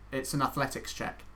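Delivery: ordinary talk, nothing marked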